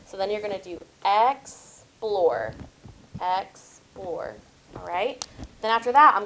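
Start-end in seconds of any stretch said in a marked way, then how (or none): 1.1-4.3 "explore" slowly split into ex, plore; syllable by syllable. Shown as a demonstration. Teacher models tapping for the class, "explore" split by syllable. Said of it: "So watch me first".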